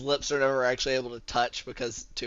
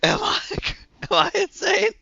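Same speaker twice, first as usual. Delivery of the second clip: laughing disbelief; 6 dB louder, slower than usual. Real, not acted.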